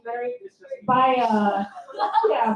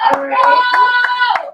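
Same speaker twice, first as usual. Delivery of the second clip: hyped up; 12 semitones higher, 9 dB louder than usual. Pretend. Hyping up the bit.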